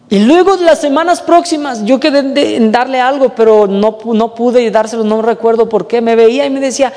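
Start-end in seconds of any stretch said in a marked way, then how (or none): none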